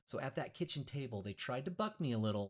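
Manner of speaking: ordinary delivery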